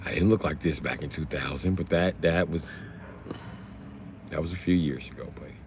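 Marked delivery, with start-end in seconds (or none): none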